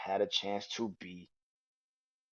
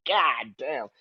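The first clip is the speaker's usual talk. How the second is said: ordinary talk, nothing marked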